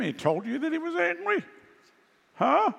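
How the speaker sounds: high, laughing disbelief; incredulous. Real, not acted. Preacher scoffing at a claim about God, laughing incredulous. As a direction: scoff at the claim, pitch soaring up.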